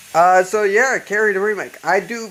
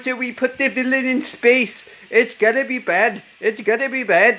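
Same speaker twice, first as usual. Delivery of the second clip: high-pitched mock Indian accent; 7 semitones higher than usual. Horror fan voicing haters of villains in space, Indian accent. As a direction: put on an exaggerated Indian accent. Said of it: "I did an Indian accent".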